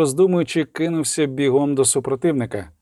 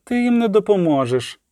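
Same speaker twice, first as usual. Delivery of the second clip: understated dull-voiced reply, raised pitch; hollow voice; 7 semitones higher than usual. A narrator's reading. Man holds medic back from the wounded, hollow voice. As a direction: answer her in a muffled, hopeless voice. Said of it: "відповів глухим голосом".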